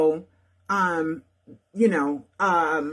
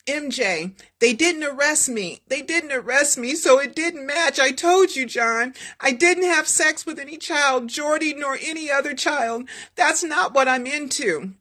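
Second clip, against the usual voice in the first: Michael insisting; 7 semitones higher than usual. Acted. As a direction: plead innocence with rising hurt. Michael protesting innocence to lawyer.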